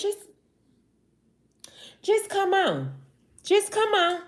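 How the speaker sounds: high, pleading come-on; coaxing call. Real, not acted. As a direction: coax them to come on down. Urging viewers to move to Mississippi.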